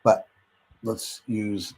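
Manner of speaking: ordinary talk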